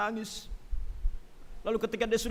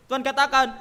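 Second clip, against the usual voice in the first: ordinary delivery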